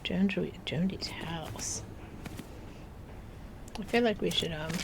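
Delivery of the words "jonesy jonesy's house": whisper. Real, not acted. Whispering villager name.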